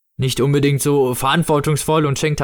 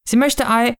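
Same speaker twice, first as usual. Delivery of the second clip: high motherly voice, cut off; mother figure; 11 semitones higher than usual. Acted. Mother answering for her child, high voice cut short. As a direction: begin brightly high, then break off.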